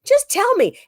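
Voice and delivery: high, loud plea to be told; insistent demand. Retold or imitated. Pressing the PTA president, a high loud demand. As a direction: beg loudly and high. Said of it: "I'm like".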